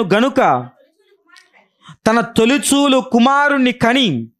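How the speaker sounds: slow, high, solemn scripture reading. Acted. Preacher reading Luke's gospel aloud, slow and solemn. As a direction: read the verse slowly and solemnly. Reads Luke 2 verse.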